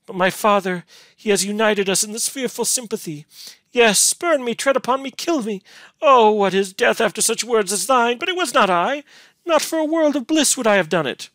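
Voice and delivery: high, loud desperate protest; anguished, impassioned heroine's outcry; shrieking. A narrator's reading. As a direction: plead frantically, voice held very high. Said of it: "shrieked Beatrice".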